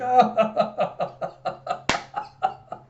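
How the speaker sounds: high, long staccato laugh; cackling laugh. Retold or imitated. George laughs at his own poetic misery, hysterical. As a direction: let the laugh run on in breathless bursts. Breaks up after melodramatic quote.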